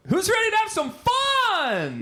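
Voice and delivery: high, drawn-out hype shout; hyped show opener. Acted. Host kicks off the podcast, hyped high holler.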